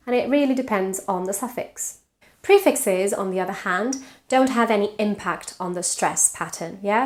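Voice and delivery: usual speaking voice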